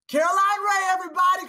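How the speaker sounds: announcer introduction. Real, not acted. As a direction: hype up the guest's entrance. Introducing the guest co-host.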